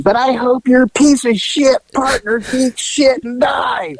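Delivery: slow shouted curse. Retold or imitated. Arrested woman cursing the partner in a slow shout. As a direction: spit the curse out slowly.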